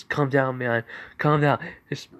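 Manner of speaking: mildly anxious henchman; nervous partner. Acted. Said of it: "partner says".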